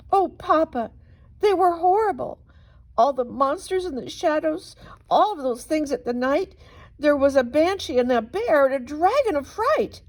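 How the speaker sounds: high, breathless, frightened telling; shaken child. Acted. Daughter tells her father of the monsters, high and frightened. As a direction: pour out the fright to Papa.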